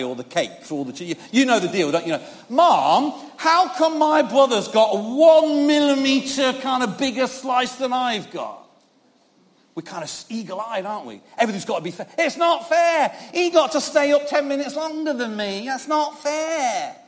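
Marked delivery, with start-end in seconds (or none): 2.5-8.6 put-on childish whine. Child whining to mum. 12.2-17.0 put-on childish whine. Child's complaint voiced.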